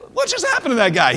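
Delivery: normal talking voice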